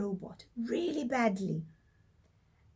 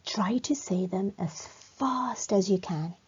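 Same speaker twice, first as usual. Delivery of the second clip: whisper; 3 semitones lower than usual. Acted. Whispered instruction.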